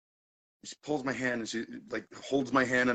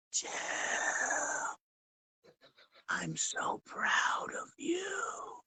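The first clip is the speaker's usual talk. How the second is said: very slow, breath-heavy whispered gushing; hammed-up, drawn-out whispery sob; mock emotional whisper; much slower than usual. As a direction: imitate a drunk girl whispering tearfully close. Imitates drunk girl holding him close.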